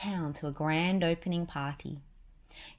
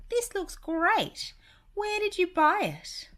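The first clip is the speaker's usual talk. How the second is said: high, slow cartoon mouse girl; drawn out and high like Minnie; 5 dB louder, slower than usual. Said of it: "said Minnie".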